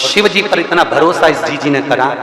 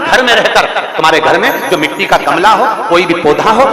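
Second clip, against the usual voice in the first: raised-pitch, driving sermon exhortation; forceful preaching; 5 semitones higher, 4 dB louder than usual. Real, not acted. Preacher urging devotees to worship despite family objections, forceful. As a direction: urge the congregation on in insistent repeated phrases.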